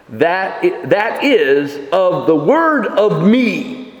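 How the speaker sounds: slow, leaning hard on "of the word of me"; emphatic. Shown as a demonstration. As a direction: press each word of "the word of me" slowly.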